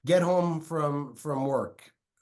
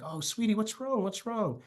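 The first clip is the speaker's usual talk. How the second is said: tender concern; 8 semitones higher than usual. Retold or imitated. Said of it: "I'm like".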